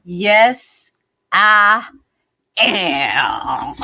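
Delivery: loud cartoon professor yes; Nutty Professor voice. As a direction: belt it out like a goofy professor. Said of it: "Nutty Professor voice".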